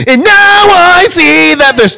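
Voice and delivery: high laughing while singing. Real, not acted. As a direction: crack up mid song.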